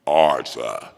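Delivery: very deep stern-father call; character catchphrase. Pretend. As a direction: drop far down and command. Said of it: "your variation of boy in different languages".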